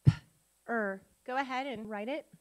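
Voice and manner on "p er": P-er as a puffed p, pause, then er; sounded out. Shown as a demonstration. Teacher sounding out a syllable with students, P-er in two sounds. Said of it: "Sound it out".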